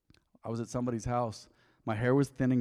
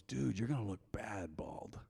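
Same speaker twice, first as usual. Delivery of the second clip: slow, near-whispered retold verdict; hushed verdict; 3 semitones lower, 10 dB quieter, slower than usual. Friend judges the shaved head, hushed verdict. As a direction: drop it low and soft, drawn out.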